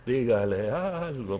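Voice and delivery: drawn-out inner grumble; sceptical echo. Retold or imitated. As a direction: mull the request over doubtfully. Pastor echoes her in thought.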